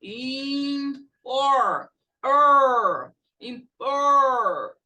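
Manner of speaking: "Impor" broken off and drawn out on the r; loud, held "Er" inside "Impor"; ER sound drill. Shown as a demonstration. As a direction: stretch the r-vowel in "Impor". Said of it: "como a palavra important".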